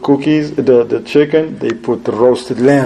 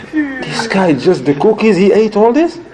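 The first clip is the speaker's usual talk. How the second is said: mock astonished hosts; 7 semitones higher than usual. Hosts' astonished reaction retold.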